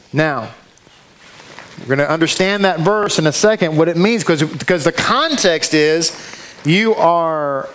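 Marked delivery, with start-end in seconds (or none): none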